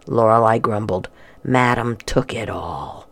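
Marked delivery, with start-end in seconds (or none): 1.4-3.1 whisper. Acted. Disappointed at empty chest.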